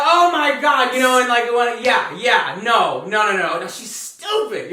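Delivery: loud imitation of everyday family chatter; family yelling. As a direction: yell it as normal conversation. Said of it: "we're always yelling".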